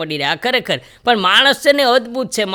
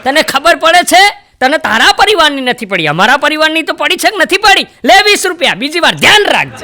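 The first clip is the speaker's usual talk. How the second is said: higher, louder mock scolding; 6 semitones higher, 5 dB louder than usual. Passenger scolding the rickshaw driver, loud mock anger. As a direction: tell the driver off, hammed up.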